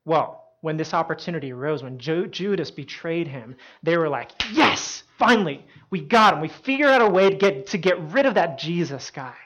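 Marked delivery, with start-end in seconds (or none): 4.5-9.3 raised, punchy celebration easing off by the end; gleeful relief. Retold or imitated. Officials cheering over Judas's betrayal, gleeful relief. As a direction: act out delighted schemers finally getting their man.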